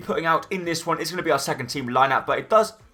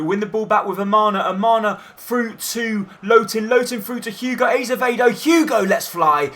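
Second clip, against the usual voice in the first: higher, rising pitch as the attack builds; excited commentary; 6 semitones higher than usual. Acted. Commentator calling the attack as it builds, rising excitement. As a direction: call the move with climbing excitement.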